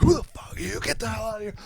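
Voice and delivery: high-pitched mock scream, chasing intruders off; high, hammed-up yell; retold screaming. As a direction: imitate a friend screaming at intruders. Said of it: "He comes screaming out".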